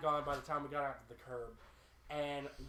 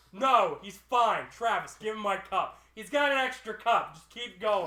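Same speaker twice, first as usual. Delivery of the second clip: raised voice, urgent and firm; loud insisting; 3 semitones higher, 9 dB louder than usual. Retold or imitated. Passenger telling cabbie not to stop, loud insisting. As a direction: overrule the driver at full volume.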